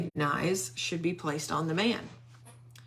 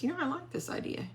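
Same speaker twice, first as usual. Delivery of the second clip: high, quick and breezy king, much softer; pleased king; 11 semitones higher, 5 dB quieter, much faster than usual. Retold or imitated. King tells Haman he likes the idea, high and quick. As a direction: toss it off lightly as the delighted king.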